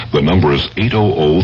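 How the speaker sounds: usual speaking voice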